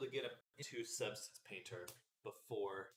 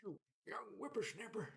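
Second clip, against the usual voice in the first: lightly voiced old man, whispered; 9 semitones higher than usual. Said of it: "That's my old man voice".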